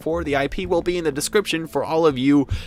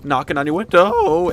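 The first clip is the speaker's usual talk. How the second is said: high, loud crack on WINDOW; 5 semitones higher, 7 dB louder than usual. Retold or imitated. Vlogger mimics classmate reciting a lyric, voice crack. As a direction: voice leaps and breaks on window. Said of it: "try and mimic this voice crack".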